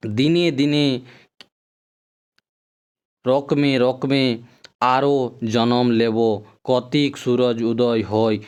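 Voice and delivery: slow, lifted poem reading; measured, drawn-out verse reading; poem recitation. Acted. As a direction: recite each line slowly for the class. Teacher recites Nagpuri poem lines.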